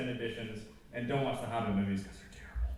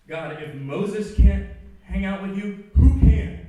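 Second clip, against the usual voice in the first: much higher, loud, slow outburst; furious cry to God; 7 semitones higher, 14 dB louder, slower than usual. Retold or imitated. Preacher alone in his car, yelling at God. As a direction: shout your anger at God. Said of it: "I literally say in my car".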